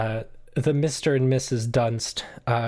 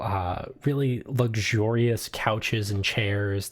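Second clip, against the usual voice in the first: normal talking voice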